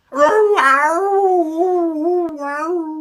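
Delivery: high, loud yowl; cat yowl. Acted. Stuck cat yowls, high and loud. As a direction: wail it like a cat. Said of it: "said Bottomley Potts".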